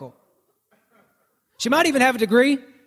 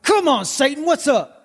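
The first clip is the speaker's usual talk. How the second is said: cocky challenge; 5 semitones higher than usual. Retold or imitated. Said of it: "and I'm like".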